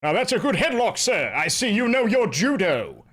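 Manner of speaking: grand, sing-song declamation; hammed-up plummy theatrical voice; theatrical orator. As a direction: compliment with pompous stage relish. Same arrest speech impression.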